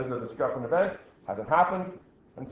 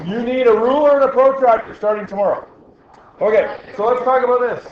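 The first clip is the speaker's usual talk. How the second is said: loud call to class; 8 semitones higher, 9 dB louder than usual. Real, not acted. Teacher calls class to attention.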